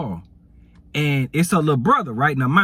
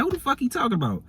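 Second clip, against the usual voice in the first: much higher and fast, incredulous; indignant question; 9 semitones higher, 4 dB quieter, faster than usual. Retold or imitated. Girlfriend to the storyteller after the call, indignant. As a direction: fire it off, high and fast.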